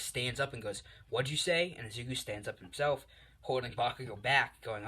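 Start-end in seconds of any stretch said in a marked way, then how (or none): 1.1-1.7 angry challenge. Acted. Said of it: "Bakugo gets upset at this".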